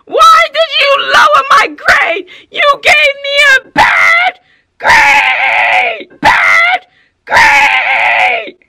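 high, drawn-out full-volume yelling; screaming student. Retold or imitated. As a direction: scream the accusation at the top of your lungs. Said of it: "Yelling, screaming to the top of their little poor lungs".

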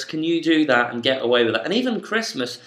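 normal talking voice

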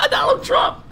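very high laughing squeal; high-pitched laughing outburst; laughing hard. Real, not acted. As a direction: crack up and squeal the name through it. Cracks up at own joke.

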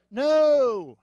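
loud, high-pitched comic protest; indignant shout, climbing to the last words; exasperated outburst. Retold or imitated. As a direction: cry out in hammed-up desperation. Retold outraged refusal to inflate raft.

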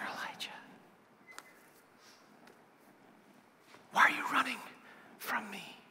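soft breathy whisper, rising on the why questions; gentle whisper. Acted. God whispering to Elijah at the cave, whisper. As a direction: whisper close, tender and searching. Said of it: "a sound of a gentle whisper".

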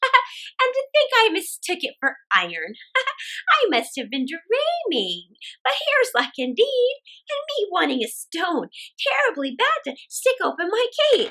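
put-on high, chuckling old woman voice; laughing old woman. Old woman finds a stone, laughing happily. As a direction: laugh at her luck.

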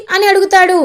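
ordinary delivery, nothing marked

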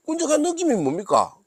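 incredulous question. Pretend. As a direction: ask like you can't believe the phrase. Incredulous question about the phrase.

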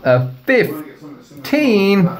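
surprised. Real, not acted. Surprised at rating.

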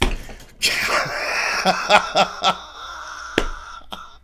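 high cruel cackle. Acted. Mirror self cackling at Mars. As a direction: cackle at him.

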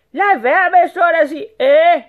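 high, loud sultan rebuke; angry scolding. A narrator's reading. Sultan scolding the hesitant rat, angry shouting.

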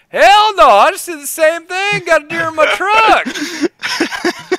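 very high, loud hollering country guy; rural hunter voice. Retold or imitated. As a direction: holler it like a proud deer hunter. Rural juror answers hollering.

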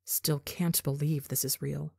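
plain reading voice

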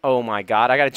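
normal talking voice

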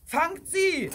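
loud, high command; urgent farmer's shout; shouted order. A narrator's reading. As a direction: order the crowd after her. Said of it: "rief der Bauer".

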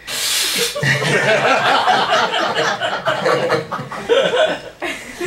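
long breathy high laugh. Acted. Long laugh during the story.

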